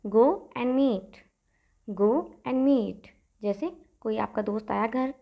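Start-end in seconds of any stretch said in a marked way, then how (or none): 0.1-3.1 very slow and even; slow drill. Shown as a demonstration. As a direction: lay out each word for the class. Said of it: "कहिए".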